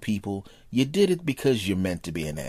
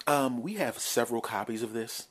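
ordinary delivery

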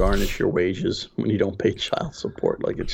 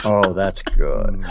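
slow, relishing as if eating something tasty; savoring food; 4 dB louder, much slower than usual. Pretend. Answering a warranty caller as if eating something delicious. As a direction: drawl it like a delicious bite. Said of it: "as if I'm eating something delicious".

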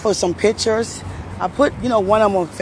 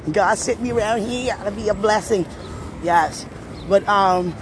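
ordinary delivery

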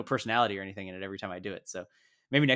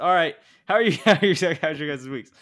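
excited greeting; 5 semitones higher, 8 dB louder than usual. Real, not acted. Loud excited greeting to cohosts.

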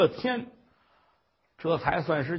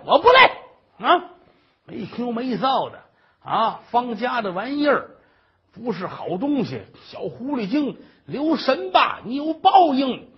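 high, theatrical scolding; hammed-up defiant tirade with stretched high notes; rooftop cursing; 7 semitones higher, 7 dB louder than usual. Old mother refusing to come down and cursing on, indignant. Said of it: "妈得上房骂街去".

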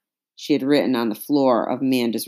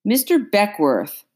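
higher, tense call of the name; high and nervous; 6 semitones higher than usual. A narrator's reading. Father pleading with the slave catcher, high and nervous. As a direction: call out, pitched up with nerves. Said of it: "Papa's voice rang high and nervous".

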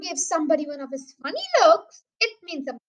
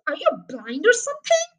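raised, incredulous jab; shouted; 4 semitones higher, 6 dB louder than usual. A narrator's reading. Mister Hazel scorns the doubting sergeant, shouting. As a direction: demand it loud and high. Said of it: "shouted Mister Hazel".

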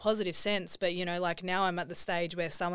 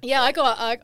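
ordinary talk, nothing marked

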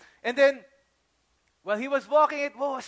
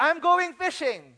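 slow, louder, higher giving-up line; resigned Peter; 6 semitones higher, 6 dB louder, slower than usual. Acted. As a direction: announce you're quitting. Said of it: "let me go back to what I'm good at".

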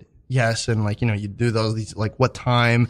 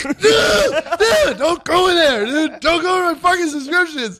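high frantic pleading yell; panicked yell; slower than usual. Retold or imitated. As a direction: beg her to stop, high and panicked. Panicking as worker opens subscriptions.

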